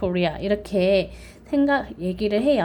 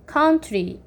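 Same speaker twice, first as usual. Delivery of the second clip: "country" high on the first syllable; stress on OU; 6 semitones higher than usual. Shown as a demonstration. Teacher demonstrates vowel stress, first syllable stressed. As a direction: hit "coun" in "country", drop "try". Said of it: "O-U에 강세를".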